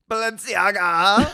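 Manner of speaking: drawn-out grand announcement; announcer voice. Pretend. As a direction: present the guest with a flourish. Announcing special guest.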